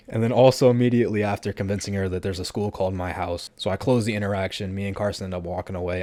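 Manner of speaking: usual speaking voice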